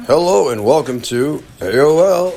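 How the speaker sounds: slow, loud announcer read; radio announcer voice. Shown as a demonstration. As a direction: boom it out like a radio host. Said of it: "trying to do a deeper voice, like a radio voice".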